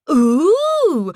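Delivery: a loud, high, sneering ooh; drawn-out taunting coo; mocking ooh. A narrator's reading. Paisley mocking Ellie on the phone, loud sneering ooh.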